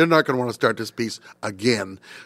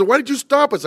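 indignant conductor; 13 semitones higher, 4 dB louder than usual. Retold or imitated. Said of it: "He says".

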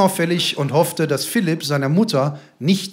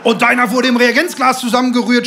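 loud, higher angry retort; raised-voice shouted comeback; shouting; 6 semitones higher, 6 dB louder than usual. A narrator's reading. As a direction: yell the insult back at the other father. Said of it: "schrie Lebeck".

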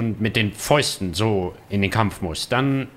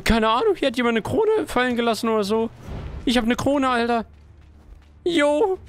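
higher, giddy bragging; excited; 13 semitones higher than usual. Real, not acted. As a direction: brag about your new crown. Showing off the crown. (aufgedreht prahlend)

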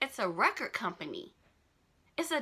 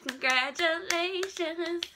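higher, slow, stretched congratulations; drawn-out congratulations; 8 semitones higher, slower than usual. Shown as a demonstration. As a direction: sing it out long and high.

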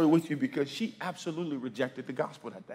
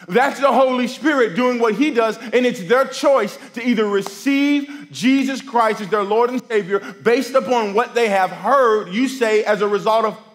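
raised preaching voice, a bit higher and louder; 8 semitones higher, 9 dB louder than usual. Acted. Preacher urging the congregation, full-voiced preaching. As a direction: preach it out to the room with conviction.

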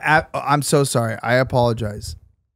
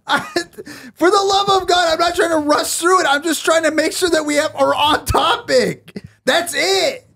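exasperated yelling. Real, not acted. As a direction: yell your defence, for the love of God. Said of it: "Am I, am I yelling right now?".

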